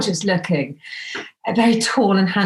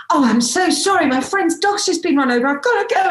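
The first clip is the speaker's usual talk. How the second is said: high, hurried fake alarm; hyped up; 9 semitones higher than usual. Telling the date she must leave, high and hyped. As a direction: act shocked to escape the date. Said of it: "So I'm all hyped up".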